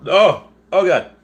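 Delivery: sharp gasp into a loud high oh God; real sudden hurt, loud and high; pained gasp. Real, not acted. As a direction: cry out as if hurt. Sudden pain.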